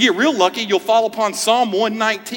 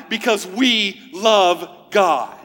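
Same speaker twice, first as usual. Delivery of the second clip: slow emphasis; much slower than usual. Real, not acted. Emphatic sermon climax.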